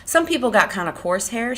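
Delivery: high, self-satisfied remark; complacent. A narrator's reading. Curley's wife bragging about her hair, complacent. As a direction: say it pleased with yourself. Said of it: "she said complacently".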